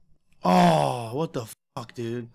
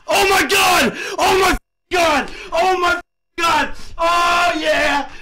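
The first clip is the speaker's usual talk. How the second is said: high, drawn-out cries of disbelief; real jackpot elation; ecstatic shouting; 8 semitones higher, much slower than usual. Real, not acted. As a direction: yell it out, overwhelmed by a huge win. Celebrating a huge gambling win.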